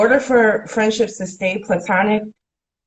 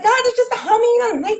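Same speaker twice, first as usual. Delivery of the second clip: mock naive protest; faster than usual. Mocking her younger self's excuse.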